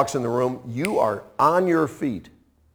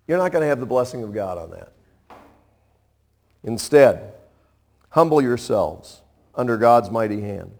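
usual speaking voice

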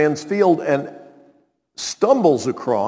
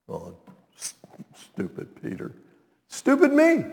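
self-mocking; 10 semitones higher than usual. Real, not acted.

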